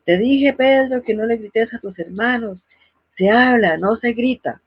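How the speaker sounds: a mother telling Pedro not to yell, while half-yelling; scolding mother. Retold or imitated. Mother scolding Pedro, mock yelling at him. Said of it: "la madre vocifera".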